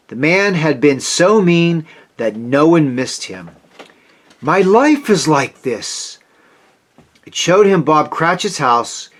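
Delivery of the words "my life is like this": horrified realisation. A narrator's reading.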